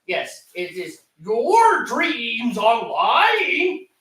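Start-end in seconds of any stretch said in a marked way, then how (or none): 1.2-3.9 stretched-out, swooping delivery; dramatic comment reading. Acted. Reading a viewer comment to a buddy, dramatically drawn out. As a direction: read it very slowly and louder, lifting at the end.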